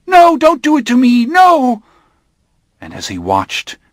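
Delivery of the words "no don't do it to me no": very high, loud panicked plea; terrified scream. Acted. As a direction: scream for your life. Armand dragged toward the fire.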